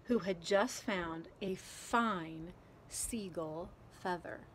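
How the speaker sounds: ordinary delivery, nothing marked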